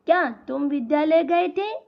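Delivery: rising question through "गए थे"; questioning tune. Shown as a demonstration. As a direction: ask it with a lift on "गए थे". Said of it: "जैसे उसको प्रश्न पूछ रहे हैं".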